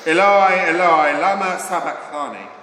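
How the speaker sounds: cried out loud and high; loud cry. A narrator's reading. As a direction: raise the voice in a crying call. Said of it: "cried out in a loud voice".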